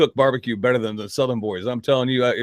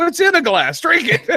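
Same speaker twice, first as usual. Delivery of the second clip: mock-exasperated retort breaking into laughter; 12 semitones higher than usual. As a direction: pitch climbing high into a laugh. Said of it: "I go".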